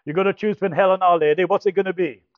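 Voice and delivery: loud, blunt Yorkshire ultimatum with a laugh at the end; Yorkshire accent. Retold or imitated. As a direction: lay down the choice in a hearty Yorkshire voice. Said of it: "'cause he was from Yorkshire".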